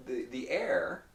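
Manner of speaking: normal talking voice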